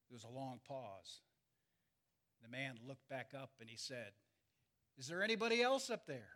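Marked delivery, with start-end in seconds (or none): 5.0-6.3 fast, higher call upward, pleading; hopeful shout. Retold or imitated. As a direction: call up again, hoping for someone else. Punchline repeat of the call.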